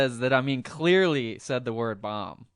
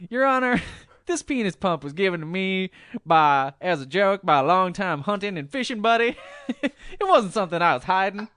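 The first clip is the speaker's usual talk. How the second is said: high, loud Ross Perot impression; 6 semitones higher, 5 dB louder than usual. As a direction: ham up a Perot twang. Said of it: "That's my Ross Perot voice".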